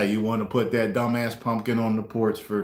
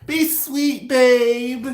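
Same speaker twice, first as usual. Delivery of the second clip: put-on girlfriend voice; 10 semitones higher, much slower than usual. Girlfriend pleading.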